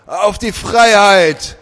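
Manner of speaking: loud, slow, high toast with a rasp; shouting. A narrator's reading. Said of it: "rief er".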